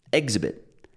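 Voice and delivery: "exhibit" front-stressed, falling away after the first syllable. Retold or imitated. Teacher repeats friend's mispronunciation, "exhibit" stressed on first syllable. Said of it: "she stressed the first syllable".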